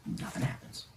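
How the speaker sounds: whispered suspense; hushed whisper. Retold or imitated. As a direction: barely whisper it, very soft. Hushed aside in suspenseful retelling.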